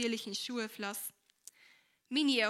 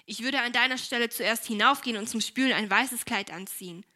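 put-on curt, louder older sister's reproach; unfriendly tone; 8 dB louder than usual. A narrator's reading. Older sister Esther scolds Helene: unfriendly, louder. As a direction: snap it at her careless little sister. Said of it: "in keineswegs freundlichem Ton".

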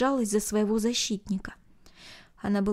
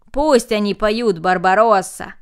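loud crowd call; loud shouted demand; shouting; 8 dB louder, slower than usual. A narrator's reading. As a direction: call out to the leader. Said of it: "закричали остальные".

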